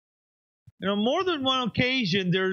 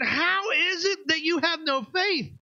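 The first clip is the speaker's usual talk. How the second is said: exasperated shout; 8 semitones higher than usual. Retold or imitated. Pastor acting Jesus rebuking scared disciples, exasperated shout. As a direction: throw the question at them, fed up. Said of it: "You think he would ask this question".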